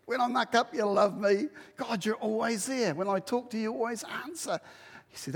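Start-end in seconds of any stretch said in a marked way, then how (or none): none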